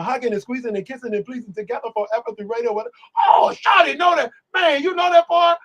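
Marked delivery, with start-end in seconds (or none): 3.3-5.6 high, loud excited shout; excited fan. Retold or imitated. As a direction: yell it like a thrilled fan who just found someone who knows the part. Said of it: "I would walk around going".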